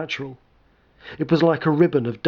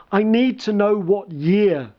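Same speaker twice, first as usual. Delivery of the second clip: sobbing; 6 semitones higher, slower than usual. Acted. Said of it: "I sobbed to myself".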